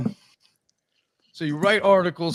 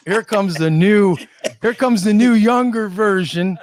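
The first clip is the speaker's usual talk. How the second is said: louder, hammed-up announcer intro; announcer build-up; 3 semitones higher, 7 dB louder than usual. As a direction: build it up like a ring announcer, laughing. Building up the new guy's entrance.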